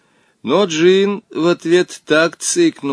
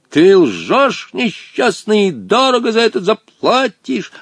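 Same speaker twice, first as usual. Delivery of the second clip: put-on high, chanted threat from an old genie; angry old genie; 9 semitones higher, 4 dB louder, slower than usual. A narrator's reading. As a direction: declaim the accusation grandly. (гневно, нараспев, голосом старого джинна)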